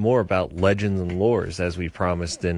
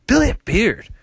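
hyped announcer; 12 semitones higher, 6 dB louder than usual. Acted. Hyped intro of guest.

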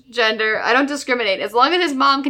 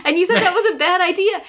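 high-pitched New Jersey wise-guy impression; exasperated New Jersey guy, pitched way up; mock Jersey voice; 7 semitones higher than usual. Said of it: "I don't know why I'm doing Jersey Boys now".

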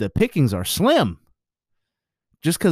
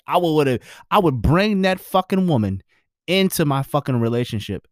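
ordinary delivery

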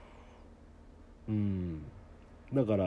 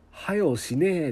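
はよしねえ in broad Okayama dialect, a little higher; showing listeners the blunt Okayama way to say hurry up; 6 semitones higher, slower than usual. Shown as a demonstration. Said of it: "岡山弁で…って言うんですよ".

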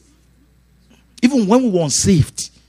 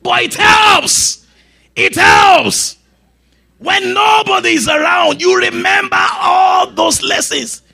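high, loud, slow preacher's shout; preaching at full force; 12 semitones higher, 4 dB louder, slower than usual. Real, not acted. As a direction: drive it home to the congregation.

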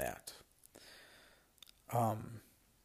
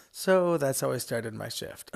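laughing; 3 semitones higher, 7 dB louder than usual. Real, not acted. Laughing at the story.

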